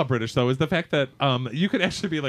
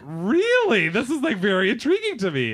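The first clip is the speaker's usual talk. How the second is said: retold intrigued reply; 7 semitones higher than usual. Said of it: "And I was like".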